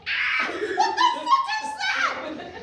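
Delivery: high, startled shriek through a laugh; shocked yell. Retold or imitated. Dad discovering his kid's search history, shrieking in shock. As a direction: imitate a dad's horrified shout.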